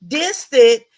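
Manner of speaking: a loud, scoffing "Distant?"; incredulous. Real, not acted. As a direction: throw the word back in disbelief. Mocking the husband's word.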